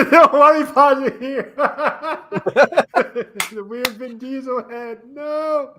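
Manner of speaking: high, helpless laughing objection; laughing protest. Real, not acted. Co-host begs off the weird paused frame, laughing.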